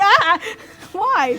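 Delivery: high loud mock alarm; startled whine. GM acting out a fright at night, high loud mock alarm. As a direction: cry out at the noise, then plead.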